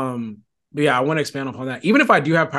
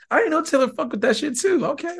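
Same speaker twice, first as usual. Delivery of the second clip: much higher mock-amazed voice; imitated impressed surprise; 11 semitones higher than usual. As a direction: act out a pleasantly stunned listener, pitched way up. Imagined listener impressed by Taylor.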